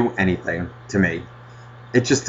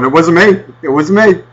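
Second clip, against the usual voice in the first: louder, higher panicky denial; mock guilty denial; 4 semitones higher, 5 dB louder than usual. Running back into the house, mock sheepish denial. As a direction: blurt it out like a fleeing culprit. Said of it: "with my tail between my legs going".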